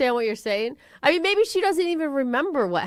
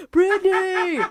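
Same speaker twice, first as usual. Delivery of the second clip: mock yell; 8 semitones higher, 3 dB louder, slower than usual. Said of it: "'cause he was yelling".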